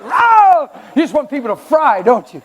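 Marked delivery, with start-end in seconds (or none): 0.0-0.7 mock cry. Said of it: "You like".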